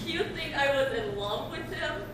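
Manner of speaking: slow, soft, laughing disbelief; scoffing laughter. Acted. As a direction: laugh at the very idea. Scoffs at idea of loving him.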